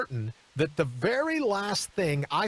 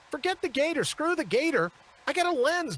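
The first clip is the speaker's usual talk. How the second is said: frantic inner thought; 10 semitones higher than usual. Retold or imitated. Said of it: "I remember thinking to myself".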